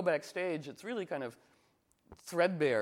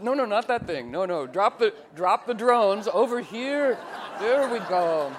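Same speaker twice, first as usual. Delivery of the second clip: dog training voice; 7 semitones higher, 8 dB louder than usual. Pretend.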